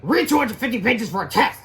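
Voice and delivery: high, loud homework demand; yelling teacher. A narrator's reading. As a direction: bark the assignment at the class. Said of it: "yelled at us all the time".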